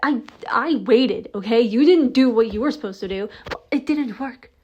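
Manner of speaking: loud, high, accusing shouts at the partner; angry confrontation. Acted. Yelling at the partner over a failed plan, furious. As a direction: demand answers, furious.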